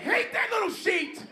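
high-pitched laughing yell; high laughing shout. Real, not acted.

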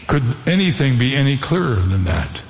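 normal talking voice

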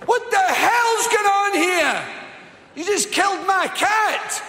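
high, slow, loud outraged yell; furious woman shouting. Retold or imitated. Woman screaming at the driver over her cat.

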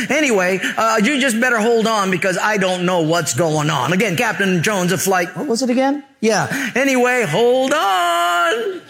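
clueless pilot rambling on the mic, ending high; bumbling cabin announcement; airline captain on intercom. Acted. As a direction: play a muddled captain signing off, last words stretched way up. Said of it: "if he sounds like that".

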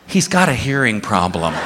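ordinary delivery

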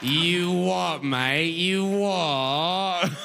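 drawn-out, hammed-up British parroting; mock British accent. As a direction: stretch the words in a silly British accent. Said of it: "They're just making sounds you like".

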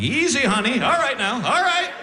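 high, rising strained soothing; calm her down while getting crushed, voice climbing; hammed-up nervous easy honey; mock panic. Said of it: "I'm like".

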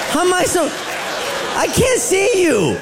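high, slow, indignant yell; mock outraged yell. Wife yelling down the phone, drawn out and high. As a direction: stretch it out like an offended wife.

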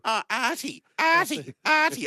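high, repeated name call. Real, not acted. Calling out to a colleague.

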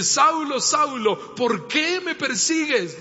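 grave divine voice confronting Saulo; voice of Christ calling. Acted. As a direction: call out to him, then ask slowly.

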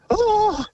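very high imitated gasp of alarm; mock shocked gasp. Firefighter imitates neighbour learning of the fire, mock shocked gasp.

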